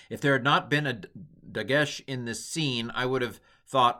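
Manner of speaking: normal talking voice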